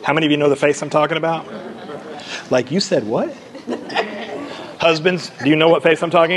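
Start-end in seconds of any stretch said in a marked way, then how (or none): 2.5-3.3 mild disbelieving double take; incredulous. Acted. Woman at the well doubting Jesus, incredulous. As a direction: question that swoops up on the last word. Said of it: "She makes a face".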